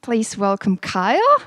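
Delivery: ordinary talk, nothing marked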